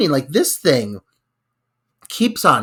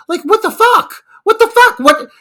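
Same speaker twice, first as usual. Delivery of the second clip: much higher and louder, disbelieving; incredulous outburst; 7 dB louder than usual. Real, not acted. Host outraged at the tunnel panic, loud disbelief. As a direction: let the outrage burst out high.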